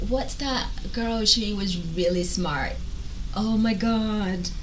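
ordinary delivery

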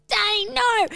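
put-on high, loud child's wail of protest; upset little girl. Retelling the stepsister's protest at dinner, in a high child's wail. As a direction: squeal it like a small kid refusing.

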